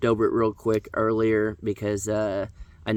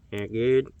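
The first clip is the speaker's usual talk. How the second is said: country guy with dip. Pretend. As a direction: mumble it round a dip in the lip. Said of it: "country guy with a dip in my lip".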